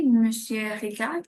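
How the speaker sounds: ordinary delivery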